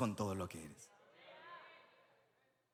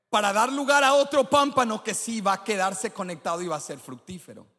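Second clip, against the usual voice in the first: loud, much higher sermon climax over music; preaching at full force; 8 semitones higher, 7 dB louder than usual. Acted. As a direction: drive the point home to the crowd.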